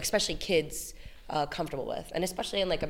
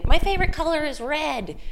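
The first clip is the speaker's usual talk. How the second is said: put-on high, louder voice of a child declaring a favorite; kid stating opinion; 10 semitones higher, 6 dB louder than usual. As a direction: pipe up like a kid. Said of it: "if you're a kid".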